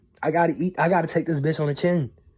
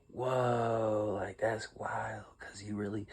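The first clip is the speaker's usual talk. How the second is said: quiet stunned aside; hushed disbelief; 3 semitones lower, 13 dB quieter, slower than usual. Real, not acted. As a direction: drop almost to a whisper, slow.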